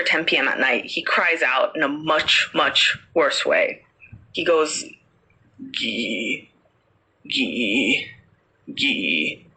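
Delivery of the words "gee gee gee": low, drawn-out creak, repeated with pauses; weird creaking noise. Acted. Parrot mimicking the rope after ten, as a creaking noise. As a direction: imitate a rope creaking on wood, low in the throat. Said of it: "that weird creaking noise".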